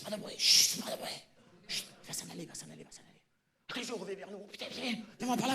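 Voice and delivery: high panicked whisper; whispering while hiding. Acted. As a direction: hiss it so the guard won't hear. Hiding from the guard. (chuchotement paniqué en se cachant)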